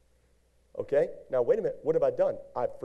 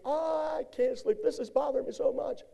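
whiny sleepless voice; 11 semitones higher, 4 dB quieter than usual. Retold or imitated. Imitating sleepless grudge holder.

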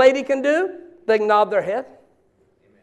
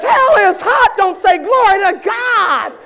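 much higher, loud pulpit cry; preaching shout; 9 semitones higher, 4 dB louder than usual. Retold or imitated. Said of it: "So the pastor says".

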